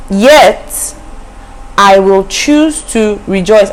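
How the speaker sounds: slow, lifted reading of the verse; scripture reading. Shown as a demonstration. Preacher quoting the verse to worried listeners, slow and lifted. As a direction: linger on choose. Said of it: "in verse eighteen, it says that".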